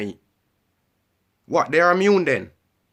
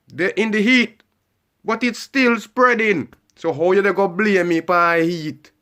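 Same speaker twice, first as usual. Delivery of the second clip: heated outburst; 6 semitones higher, 5 dB louder, slower than usual. Real, not acted. Heated rant about blame.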